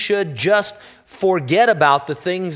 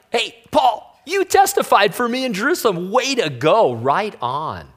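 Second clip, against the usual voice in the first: higher, eager praise, like a proud friend cheering; excited Jesus; 5 semitones higher than usual. Retold or imitated. Jesus cheering Paul for his testimony, excited. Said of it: "Jesus is all excited about it".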